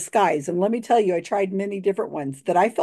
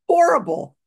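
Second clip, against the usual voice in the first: horrible; 10 semitones higher, 4 dB louder than usual. Real, not acted. Mocking her own Southern accent.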